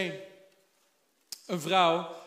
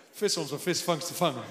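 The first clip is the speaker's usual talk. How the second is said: careful retry; 4 semitones lower than usual. Shown as a demonstration. Preacher retrying the tongue twister for the church, carefully. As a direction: spell out "visvangst" deliberately. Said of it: "Dat is een tongue twister".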